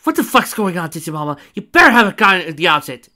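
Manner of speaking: high, loud angry yell; screaming. Acted. Driver yelling at the agent, high and loud. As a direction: yell at her in panic. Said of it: "the girl screamed to her".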